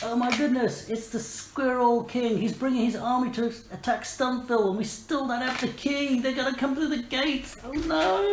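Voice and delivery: high-pitched squeaky alarm; panicked mouse. Acted. Mouse warns heroes of an attacking army, high panic. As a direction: fret in a small high voice, rising to a wail. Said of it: "one of the mice runs up".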